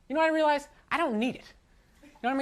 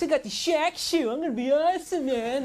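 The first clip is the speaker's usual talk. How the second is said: cocky guy; 5 semitones higher than usual. Retold or imitated. Quoting a guy in new shoes.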